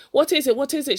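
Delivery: loud, high frightened cry; mother bursting in, crying out; alarmed cry. A narrator's reading. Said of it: "she cried".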